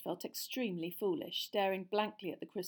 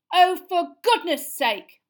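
much louder, higher, slow outburst; loud exasperation; 10 semitones higher, 18 dB louder, slower than usual. A narrator's reading. Girl interrupting her teacher, loud and exasperated. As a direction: throw up your hands in exasperation. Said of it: "said Hermione loudly".

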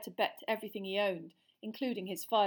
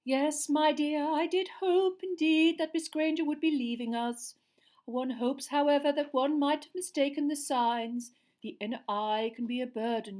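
dreamy seer; 7 semitones higher, 8 dB louder than usual. A narrator's reading. Seer speaking dreamily to her class. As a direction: float each word out like a seer in a trance. Trelawney voice.

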